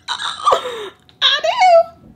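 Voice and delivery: squeaky high "I do" through a laugh. Acted. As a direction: let the laugh break the words. Dissolving into laughter on repeat.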